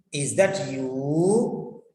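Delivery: questioning rise on a long YOU; questioning attitude. Shown as a demonstration. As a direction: ask it slowly, sweeping upward on "you". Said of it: "that means questioning attitude".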